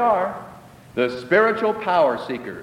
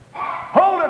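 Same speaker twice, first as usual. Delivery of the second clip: sharp high call to halt a passerby; 13 semitones higher than usual. Acted. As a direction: stop him in his tracks from a distance. Woman calls out to stop you.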